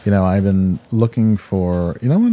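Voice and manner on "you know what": sudden idea. Real, not acted. Sudden pitch jump.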